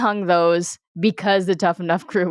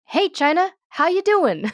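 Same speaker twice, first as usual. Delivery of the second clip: mock cheery greeting; 10 semitones higher than usual. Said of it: "she started hers with".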